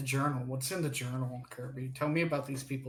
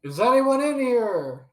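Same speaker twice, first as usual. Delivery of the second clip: loud, high, drawn-out call; 10 semitones higher, 12 dB louder, slower than usual. Acted. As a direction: holler it slowly through an empty house. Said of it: "Argo will call out".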